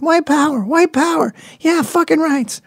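very high squeaky impression, gleefully joining in; High Pitch Eric. Mimicking the duped man diving right into it, high-pitched voice. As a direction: squeal it like an over-eager convert. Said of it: "High Pitch Eric".

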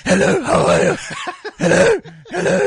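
hammed-up imitation of a politician saying hello; Helen Zille impression. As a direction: parody her greeting, lifted and grating. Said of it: "Her voice sounds like the way Day Zero looks".

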